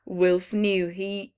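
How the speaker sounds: usual speaking voice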